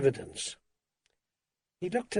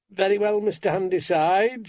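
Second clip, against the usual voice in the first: put-on brisk, louder Grimble accent, pitched up; higher-voiced woman with a Grimble accent; 6 semitones higher, 4 dB louder than usual. A narrator's reading. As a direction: speak up firmly in a Grimble accent. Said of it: "she was saying in a Grimble accent".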